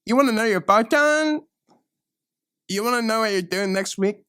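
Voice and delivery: high, loud taunting sneer; mocking high shout. Bratty teen fortune teller taunting a customer, high and loud. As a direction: jeer it up high at them.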